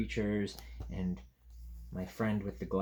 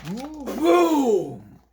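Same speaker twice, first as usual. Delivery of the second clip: loud sound-effect boom; 14 dB louder than usual. Acted. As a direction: make the crash big for the kids.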